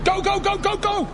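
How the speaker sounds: high, urgent, rapid calls. Acted. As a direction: hurry everyone into the getaway car. Urgent getaway command.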